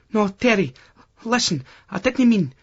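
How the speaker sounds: put-on a flustered girlfriend trying to get a word in; Lucy interrupting. A narrator's reading. Lucy voiced higher in story.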